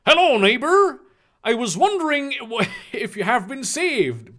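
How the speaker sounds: high, hammed-up cheery greeting; exclaimed brightly. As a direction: call out like an over-friendly preaching neighbor. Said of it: "Hagrid exclaimed brightly".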